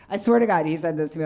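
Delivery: ordinary talk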